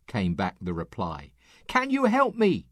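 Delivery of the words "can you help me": high, pleading call for help; pleading cry. Acted. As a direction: beg from the branch, voice way up. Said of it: "cried the hiker".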